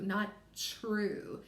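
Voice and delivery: normal talking voice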